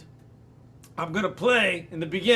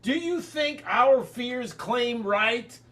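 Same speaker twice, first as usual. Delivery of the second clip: slow, rising to a shout; poem reading; 4 semitones higher, slower than usual. Acted. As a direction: read it slow, swelling to the last line. Reads rest of poem over music.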